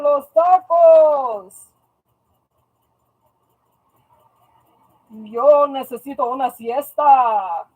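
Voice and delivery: dramatic Telemundo Spanish announcer, slow. Pretend. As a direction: announce it like a Spanish soap host. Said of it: "when I try and do the Telemundo voice".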